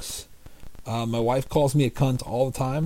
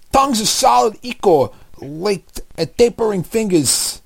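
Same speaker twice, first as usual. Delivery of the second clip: Joe Pesci impression; 7 semitones higher, 3 dB louder than usual. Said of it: "Um, Pesci".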